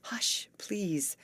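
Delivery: whispered hush; gentle Amy hushing her quarrelling friends, whispering; gentle whispered plea. A narrator's reading. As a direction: breathe it, begging them gently to hush. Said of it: "begged gentle Amy".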